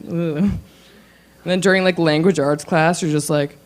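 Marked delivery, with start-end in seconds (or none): none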